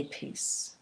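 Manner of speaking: soft whispered "Peace" on the out-breath. Shown as a demonstration. Coach showing the word to say after the breath, whispered. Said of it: "and say".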